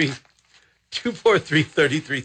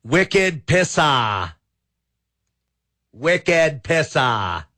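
drawn-out, higher Boston-accent drawl; attempted Boston accent; 4 semitones higher, much slower than usual. Shown as a demonstration. Radio host trying out a firework name in a Boston accent. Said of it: "say that in a Boston accent".

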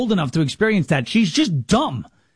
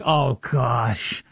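mock groan; 9 semitones lower, 3 dB quieter than usual. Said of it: "He's like".

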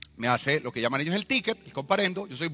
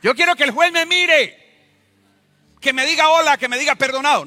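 high, loud cry of longing; impassioned shouted plea; 12 semitones higher, 10 dB louder than usual. Acted. Man yearning for the judge's pardon, impassioned shouting. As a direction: cry out to be acknowledged.

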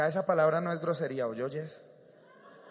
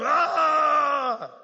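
high, loud drawn-out "ah"; imitated crowd shout; 10 semitones higher, 7 dB louder than usual. Preacher acting out Israel's shout as the ark arrives, loud yell. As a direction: cry out like a rejoicing army.

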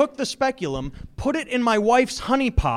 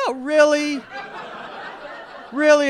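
sarcastic disbelief; 6 semitones higher than usual. Real, not acted.

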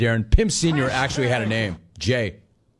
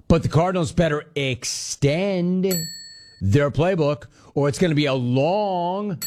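slow dramatic reading with rising emphasis; read-aloud email; 4 semitones higher, slower than usual. Retold or imitated. Host dramatically reading a listener's email, slow and ominous. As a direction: read the listener's take slowly, like an ominous warning. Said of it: "Brian in San Pedro".

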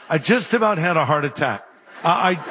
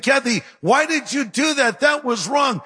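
raised, indignant protest; high, outraged husband scolding his wife; 7 semitones higher than usual. Retold or imitated. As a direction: call out the wrong, pitched up high. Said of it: "I was outraged".

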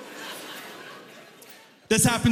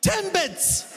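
much higher, clipped and insistent with a laugh in it; impatient customer; 10 semitones higher than usual. Retold or imitated. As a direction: customer repeating the obvious word. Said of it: "She's like".

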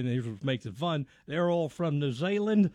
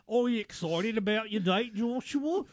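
higher, mock New Zealand accent; 6 semitones higher than usual. Imitating family asking son about date, New Zealand accent. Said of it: "because of the accent".

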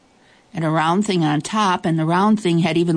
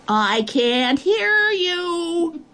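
high, slow, drawn-out call; creature shouting back; 12 semitones higher, much slower than usual. Acted. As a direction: stretch the words to carry far. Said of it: "the Nobble shouted back".

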